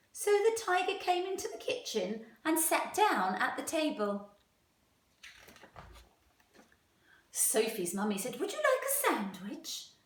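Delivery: lively, expressive storytime voice; animated picture-book reading; reading to children. A narrator's reading. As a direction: read it aloud to a class of young children. Teacher reads story to class.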